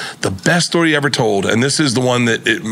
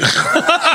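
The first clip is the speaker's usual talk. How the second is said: high laugh. Real, not acted. Laughing at own story.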